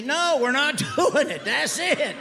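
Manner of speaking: high, slow no, laughing through it; laughing refusal. Retold or imitated. Dad laughing as he turns down his son. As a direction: refuse the kids while cracking up.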